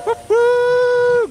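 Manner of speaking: high, loud joyful whoop; excited whoop. Real, not acted. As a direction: let out a big cheer. Cheering on the ride.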